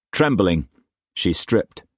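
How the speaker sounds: ordinary delivery, nothing marked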